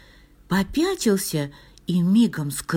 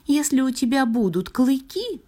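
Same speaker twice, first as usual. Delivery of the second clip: high-pitched star voice; high, persuasive little star; tempting star; 8 semitones higher than usual. A narrator's reading. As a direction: coax in a high storybook voice.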